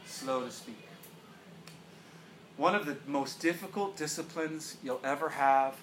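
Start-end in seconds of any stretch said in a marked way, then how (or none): 0.0-0.8 quiet repeat. Shown as a demonstration. Pastor repeating the verse to the congregation, soft and low. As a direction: let it sink in, quietly.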